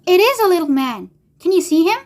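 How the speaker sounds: put-on high, eager young Charlie; high-pitched storybook exclamation; excited cry. A narrator's reading. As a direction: call out in amazed excitement. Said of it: "cried Charlie".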